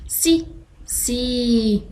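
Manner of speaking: سي drawn out slowly; teacher modelling سي slowly; slow syllable demo. Shown as a demonstration. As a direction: stretch سي for the class. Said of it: "ألفظ حرفي السين والشين".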